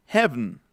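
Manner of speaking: 'heaven' as HEV-n, last e swallowed; dropped final vowel. Shown as a demonstration. Teacher modelling for students, 'heaven' without its last e. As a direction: go straight from v to n in 'heaven'. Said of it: "como si no existiera la última E".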